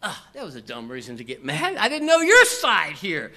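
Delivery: a hint of sheepish laughing apology; sheepish husband. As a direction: laugh it off to your wife. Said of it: "you talk to your wife and you say".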